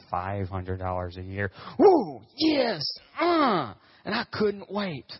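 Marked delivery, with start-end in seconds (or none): 1.8-3.7 high, loud whoops of celebration; hammed-up, high-pitched triumphant cheer; exuberant cheering. Pastor celebrating his tiny part-time salary, exuberant cheering. 4.0-5.1 high, drawn-out giddy squeal; hammed-up eagerness, pitched high and slow; giddy anticipation. Pastor recalling his first church job, giddy anticipation. Mock thrill at tiny salary.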